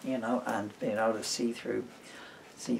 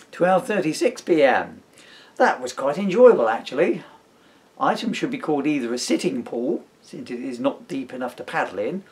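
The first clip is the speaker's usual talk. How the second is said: put-on storybook reading in the baby's diary voice; reading aloud; 6 dB louder than usual. Dad reading his book to his son, lightly voiced story reading. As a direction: read the diary entry out with a dry wink.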